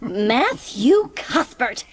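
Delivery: slow, indignant call of his full name; reproachful exclamation. Acted. Marilla scolding Matthew for laughing, indignant.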